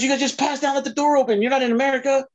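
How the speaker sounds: high-pitched mock scolding; pissed-off scolding. Angry man scolding passed-out wrestlers, imitated high-pitched telling-off. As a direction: imitate him telling off the careless pair. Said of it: "He was so pissed off at us".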